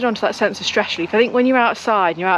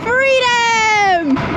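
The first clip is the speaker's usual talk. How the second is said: joyful cry; 10 semitones higher than usual. Real, not acted. Cheering freely while riding.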